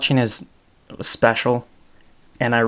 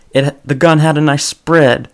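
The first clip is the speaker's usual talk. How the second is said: lightly voiced Southern gun seller, a little higher; southern accent; 3 semitones higher, 6 dB louder than usual. Retelling Bill Burr's gun store owner in a light Southern accent. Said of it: "he used a southern accent".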